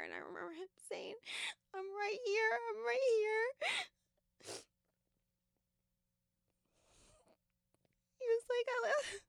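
high, breaking voice through tears; crying too hard to finish; crying real. Real, not acted. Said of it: "You're okay. Take your time".

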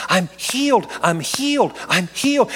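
high, exultant repeated cry; punchy, high-pitched rejoicing, each repeat emphatic; retold joyful exclamation. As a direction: imitate a woman exclaiming over her healing. Retold woman rejoicing at her healing.

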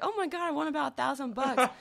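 high, loud exclamation of a win; excited re-enacted cry; retold excitement. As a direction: relive the jackpot thrill at full voice. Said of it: "And I got so excited".

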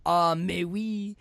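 mock French pilot accent, drawn out then punched; Roddy McDowall heavy French. Imitating a French pilot from an old TV show, hammed-up French accent. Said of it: "heavy French accent".

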